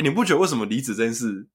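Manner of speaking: normal talking voice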